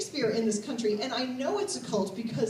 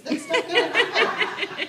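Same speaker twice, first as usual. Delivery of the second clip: higher, louder, slowed, laughing; laughing punchline; 9 semitones higher, 6 dB louder than usual. Real, not acted. Comedian to audience, laughing denial.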